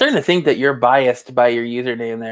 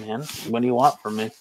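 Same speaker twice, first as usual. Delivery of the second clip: ordinary delivery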